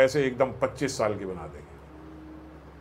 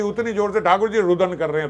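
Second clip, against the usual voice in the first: ordinary talk, nothing marked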